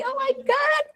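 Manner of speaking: shocked exclamation. Real, not acted. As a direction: blurt it out, astonished. Mother shocked by child's tantrum. (teriakan kaget, suara jauh lebih tinggi)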